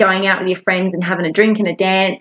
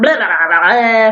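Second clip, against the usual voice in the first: mock ranting. Mock venting in an angry rant.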